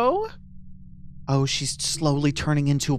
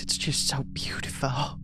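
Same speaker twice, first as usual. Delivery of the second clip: whisper; 2 semitones lower, 6 dB quieter than usual. Acted. Admiring a houseplant.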